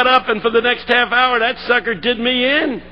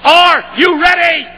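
loud, slow, higher shout of Are you ready; rousing shout; 9 semitones higher, 6 dB louder, slower than usual. Shown as a demonstration. Speaker rallying the crowd with a big shout. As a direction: call out Are you ready at full power. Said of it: "Let me try that again".

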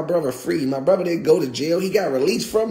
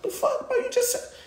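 squeaky, much higher disbelieving voice; stunned disbelief. Retold or imitated. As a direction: squeal it out, caught off guard. Narrator's stunned reaction to Rambo.

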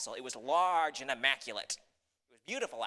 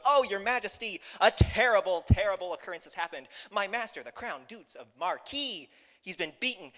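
raised, theatrical cry of distress; alarmed messenger; 5 semitones higher, 6 dB louder than usual. Acted. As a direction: report a disaster to the king, hammed-up. Cat feigns alarm to the king.

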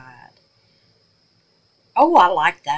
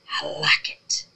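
whisper; 3 semitones lower, 3 dB quieter than usual. Real, not acted. Quiet delight at result.

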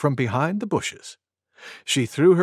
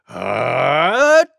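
loud sneeze; 4 dB louder than usual. Acted. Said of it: "much louder than that".

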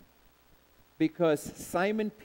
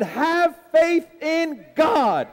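slow shouted proclamation, much higher; proclaiming; 9 semitones higher, 8 dB louder, much slower than usual. Acted. Preacher quoting Jesus to the church, slow loud shout. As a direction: shout it out slowly, every word held. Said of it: "He said".

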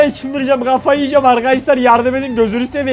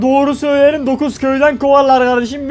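ordinary talk

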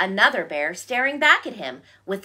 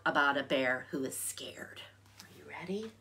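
hushed storytime whisper; 4 semitones lower, 14 dB quieter than usual. Real, not acted. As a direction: whisper it to the little ones. Whispers to children.

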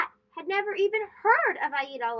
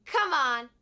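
ordinary delivery

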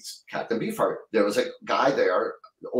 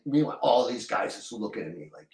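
usual speaking voice

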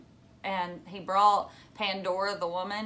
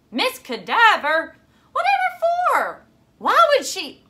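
shrill, much higher and louder outburst; alarmed disbelief; 13 semitones higher, 11 dB louder than usual. Acted. Phoebe reacting in alarm to her father's news. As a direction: leap up in pitch in alarm.

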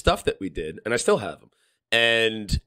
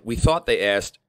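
usual speaking voice

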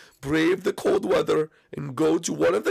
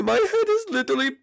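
high, crying panic; high frantic crying voice; panicked sick Mario. Acted. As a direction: squeal it like your head is on backwards. Mario panics about head.

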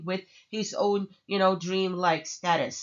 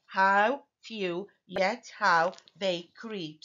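"How few, yet how they creep" slowly, each syllable set apart; much slower than usual. Shown as a demonstration. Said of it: "So I have six syllables here".

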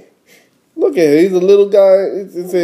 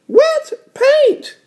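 very high little-sister squeal. Acted. Little sister revealing the words to her brother, very high voice. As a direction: announce it in a squeaky cartoon kid voice. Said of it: "said D W".

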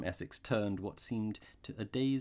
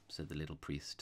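plain reading voice

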